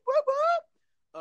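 high-pitched, loud whooping cheer; celebratory whoop. Real, not acted. Shouting out a friend, high celebratory whooping.

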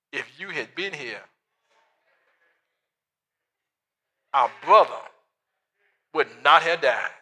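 low, reproachful complaint; with attitude. Retold or imitated. Grieving sisters scolding Jesus, low with attitude. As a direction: let the blame sit in every word. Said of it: "a little attitude behind that".

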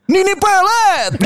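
stretched, high and slow like a TV ad announcer; announcing a horror name in a bright ad voice; cheerful ad-voice intonation. Retold or imitated. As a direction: sing out the name like a happy commercial. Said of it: "Kalau Nini Peletnya intonasi Maizon".

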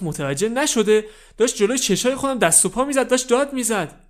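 high, loud shocked protest; upset protest. Acted. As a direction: insist he saw it happen. Kid protests friend's death.

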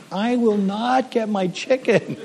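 high, soft, drawn-out complaint; put-on slow, high plea; mock pleading protest. Acted protest to God in story.

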